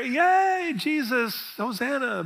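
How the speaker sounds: a mock cheer that trails off. Crowd greeting Jesus, mock half-hearted cheer. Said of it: "And they're".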